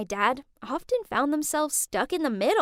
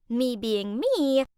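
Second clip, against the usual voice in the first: plain reading voice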